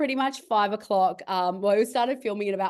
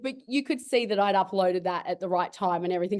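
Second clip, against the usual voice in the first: normal talking voice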